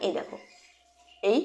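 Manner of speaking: ordinary delivery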